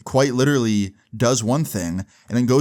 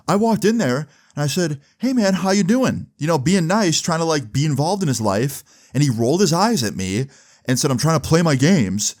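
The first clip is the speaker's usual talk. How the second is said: higher-pitched imitation of a liar playing nice; mock innocent account; 5 semitones higher than usual. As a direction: feign innocence in a raised pitch. Mocking boyfriend's lying account to mom.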